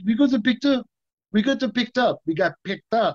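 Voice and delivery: imitating an excited Jean-Claude; lightly voiced excited friend with big news. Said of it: "He goes".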